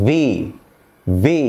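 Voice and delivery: ভি, pressed firmly; lip on teeth. Shown as a demonstration. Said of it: "শক্ত করে চাপ দিয়ে উচ্চারণ". (ভি, শক্ত চাপ দিয়ে)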